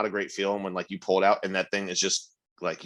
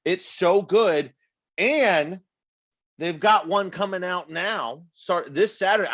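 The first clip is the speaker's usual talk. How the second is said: gushing praise; 6 semitones higher, 3 dB louder than usual. Acted. Gushing about fruity beer.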